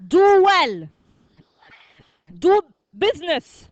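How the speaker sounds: loud, spaced-out phrases with "Do" up front; reading aloud to class. Shown as a demonstration. Teacher reads the list again, loud model reading. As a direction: call out each phrase for pupils to follow. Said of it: "Let's read them again".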